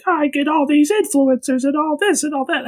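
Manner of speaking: high-pitched mock complaint; complaining wife. Husband imitates his wife griping about social media, high mock complaint.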